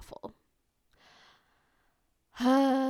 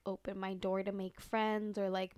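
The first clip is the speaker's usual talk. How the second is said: ordinary delivery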